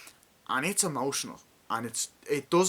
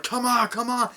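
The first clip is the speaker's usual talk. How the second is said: battlefield yell; 13 semitones higher, 8 dB louder than usual. Retold or imitated. Retold beach landing, soldiers urging.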